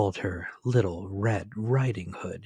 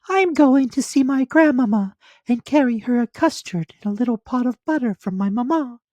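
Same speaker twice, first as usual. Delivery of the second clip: put-on much higher, louder child voice; little girl voice; 9 dB louder than usual. A narrator's reading. Little girl answering the wolf, high and childlike. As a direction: pipe it up like a small girl. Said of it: "said to him".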